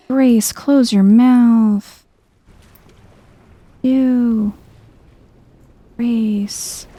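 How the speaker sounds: ordinary talk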